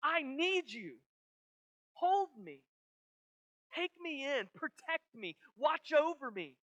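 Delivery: put-on high, loud cry of a child to its father; pleading child. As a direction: call out like a child reaching for dad. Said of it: "A child goes to his father and says".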